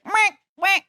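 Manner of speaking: high squeaky duck quack; duck noise. Retold or imitated. Cohost imitating a duck-like fart, high quack. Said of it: "It was like".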